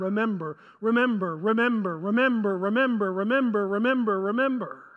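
same low falling tune on every word; droning repetition. Shown as a demonstration. Preacher shows how often the Old Testament says it, repetitive. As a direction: chain the word in an even, rolling loop without pauses.

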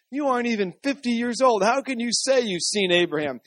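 high-pitched disbelief; incredulous challenge. A narrator's reading. The people doubting Jesus saw Abraham, incredulous challenge. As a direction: question him as if it's absurd. Crowd's disbelief in scripture reading.

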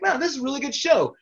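high, quick wonder; amazed kid. Retold or imitated. Twelve-year-old amazed at the wrestling show, high and quick. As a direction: re-enact a kid's amazement.

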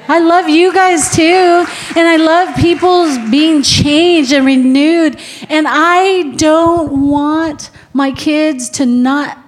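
high, loud preaching push; full-force preacher voice; fiery preaching. Acted. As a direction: drive each line home to the congregation. Preaching rises again.

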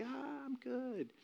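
soft, high, drawn-out brush-off; breezy self-reassurance. Retold or imitated. Son convincing himself he's over his absent dad, breezy self-reassurance. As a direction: airily wave it off in a high, quiet voice. Talking himself into being fine.

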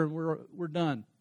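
ordinary talk, nothing marked

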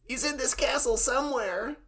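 put-on high anime kid voice; worried kid calling for his brother; Mokuba voice. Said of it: "that's more Mokuba".

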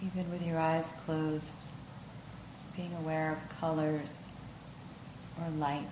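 unhurried yoga nidra voice with long pauses; guided meditation. Acted.

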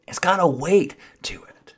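hushed whisper. Acted. Preacher hushing to convey the proverb's weight, whispered. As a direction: whisper it with reverence. Turns from mocking to reverent.

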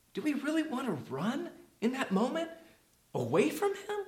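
high, soft rhetorical question rising at the end; incredulous appeal. Real, not acted. Preacher asks the congregation about running from God, soft high appeal. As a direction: lift it high and soft, searching.

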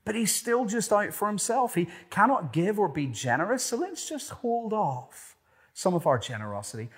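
loud, high impassioned preaching; preaching at full force. Acted. Preacher rousing the congregation, impassioned and loud. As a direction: build it like a preacher at full cry.

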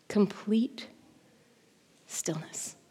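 very soft, dropping to near-silence; hushed stillness. A narrator's reading. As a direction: let it fade away after the panic. Stillness right after the panic.

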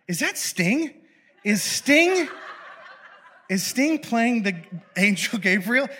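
high, laughing question; amused disbelief. Retold or imitated. Viewer spotting [name] in the painting, amused disbelief. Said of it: "I'm like".

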